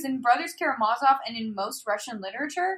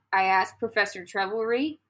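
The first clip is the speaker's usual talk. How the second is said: ordinary talk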